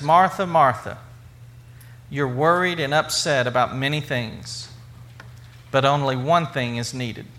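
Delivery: low, measured reassurance; calm. Acted. Jesus answering a frantic Martha, in a calm tone. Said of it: "in a calm tone".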